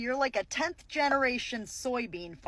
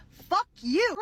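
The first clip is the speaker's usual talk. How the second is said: loud, higher, furious curse; angry outburst; 5 semitones higher, 6 dB louder than usual. Real, not acted. Commentator cursing at the cheese lady, in a loud furious outburst. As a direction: spit it out at her.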